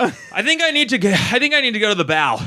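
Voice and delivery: high, queasy urgency; mock distress. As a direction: act out needing to be sick. Retelling his seasick panic aboard.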